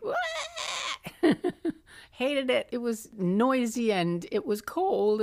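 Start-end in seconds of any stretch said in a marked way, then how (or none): 0.0-0.1 imitated baby crying wah; a playful imitation of a baby's cry; mock baby wail. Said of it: "she was just like".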